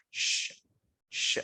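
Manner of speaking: "Sh. Sh" as two separate hushes; letter sound. Shown as a demonstration. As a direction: say "Sh. Sh" as shh, pause, shh. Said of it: "How it's pronounced?".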